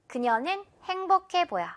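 usual speaking voice

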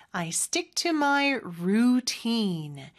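slow model sentence with "routine" drawn out long; measured demo, lengthened vowels in "routine"; slow practice model. Shown as a demonstration. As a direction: say it slowly for learners, stretching both vowels in "routine". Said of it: "같이 한번 연습해볼까요?".

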